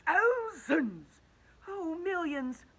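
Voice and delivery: high, rising panic; frightened cry. A narrator's reading. Travellers counting the cloud men in fright, high panicky cry. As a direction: cry out in growing fear.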